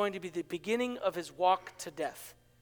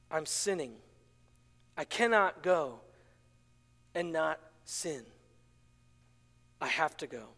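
hushed conviction; 6 semitones lower, 6 dB quieter than usual. Real, not acted. Quiet conviction after sermon point.

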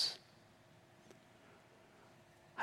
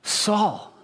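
loud, higher, emotional cry; overwhelmed outcry; 9 semitones higher, 9 dB louder than usual. Real, not acted. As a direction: it bursts out of him. Overwhelmed outcry at story climax.

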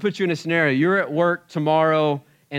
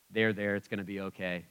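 hollow comfort; 5 semitones lower, 8 dB quieter than usual. Retold or imitated. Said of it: "I bet he would be".

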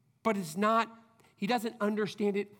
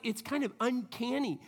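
usual speaking voice

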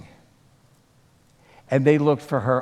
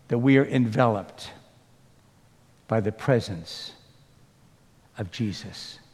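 soft, hushed closing prayer; 4 semitones lower, 3 dB quieter than usual. Acted. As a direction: pray gently over the congregation.

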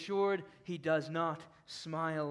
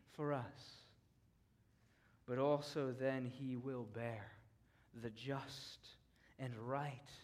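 much softer and lower, a near-whisper of dread; hushed awe; 7 semitones lower, 10 dB quieter than usual. Real, not acted. Preacher hushing his congregation before God's wrath, soft and low. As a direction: let the wrath sink in, barely above a breath.

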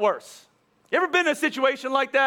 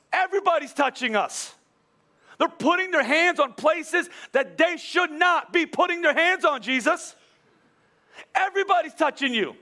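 higher, incredulous sarcasm, eyebrows raised in the voice; mock Jesus for asking the obvious; sarcastic; 6 semitones higher than usual. Peter scoffing at Jesus about the pushing crowd, sarcastic. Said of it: "He says it in a sarcastic way".

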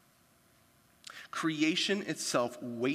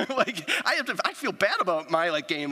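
laughing confession; 3 semitones higher, 4 dB louder than usual. Real, not acted. Laughing at own bad game.

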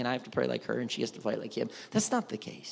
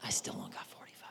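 whispered worried aside; 15 dB quieter, faster than usual. Pretend. Abraham fretting over his count, whispered. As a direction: hush it to yourself.

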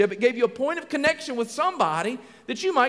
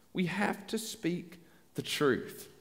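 very slow, soft and low; hushed, deliberate key point; solemn emphasis; 5 semitones lower, 10 dB quieter, much slower than usual. Real, not acted. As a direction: weigh out each word quietly. Preacher slows for his third point.

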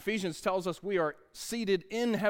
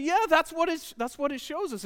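enthusiastic dad; 11 semitones higher, 5 dB louder than usual. Retold or imitated. Dad confirms to son.

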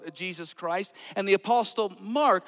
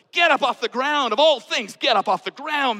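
higher and louder, scolding; mock scolding; 7 semitones higher, 11 dB louder than usual. An imagined onlooker scolding the Samaritan, loud and mock stern. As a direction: tell him off in front of everyone.

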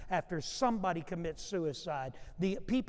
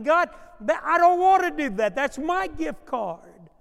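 mock protest; 9 semitones higher, 12 dB louder than usual. Preacher acts pastor's reluctant protest.

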